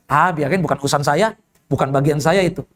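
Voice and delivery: low, offhand brush-off; dismissive. Retold or imitated. Ustaz refusing a jamaah's request for help, dismissive.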